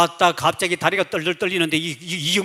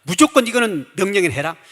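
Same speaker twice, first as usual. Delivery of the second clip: ordinary delivery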